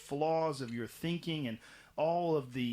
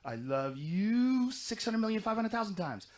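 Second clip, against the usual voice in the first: put-on childlike voice; 8 semitones higher, 3 dB louder than usual. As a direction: coo it like an adoring child. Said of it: "they would say it again".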